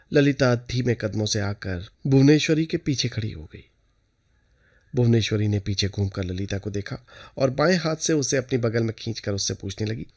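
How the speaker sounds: plain reading voice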